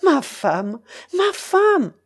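calling out, jumping high and loud on the repeat; climbing, overexcited shout; excited cry. A narrator's reading. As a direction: call to your wife in mounting excitement. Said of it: "s'écria le maire".

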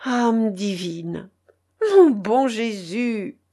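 slow, tearful-sounding pious exclamation; exclaimed. A narrator's reading. Marguerite moved by the manuscript, slow exclamation. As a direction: draw it out, swelling into a cry. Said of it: "s'écria Marguerite".

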